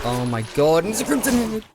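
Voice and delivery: high, loud alarmed cry; shocked yell at a monster; startled shout. Real, not acted. New monster appears in game.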